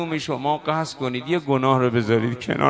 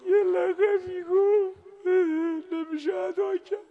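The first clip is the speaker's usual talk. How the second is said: high, cracking tearful voice; voice breaking; 12 semitones higher than usual. Real, not acted. Preacher too moved to say the title before the mourners, voice breaking. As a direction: choke on the words.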